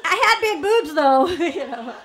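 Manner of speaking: laughing loudly through the words. Real, not acted. To the audience about nursing, laughing. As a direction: giggle it out, half embarrassed.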